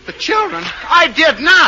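very high, outraged protest; indignant disbelief. Acted. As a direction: squawk in shocked denial. Husband indignant at false accusation.

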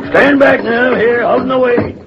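high, loud order to the crew; sharp command. Acted. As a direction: clear everyone back urgently. Captain orders crew to make room.

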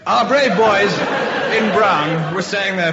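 loud proud declaiming; heroic emphasis. Acted. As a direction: proclaim it grandly. Heroic restart after interruption.

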